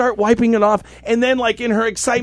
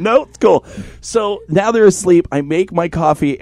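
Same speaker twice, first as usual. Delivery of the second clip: ordinary talk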